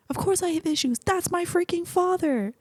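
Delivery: playful imitation of an exasperated Quill; Quill quote. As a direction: imitate Quill yelling about his father, pitched high. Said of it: "And Quill's like".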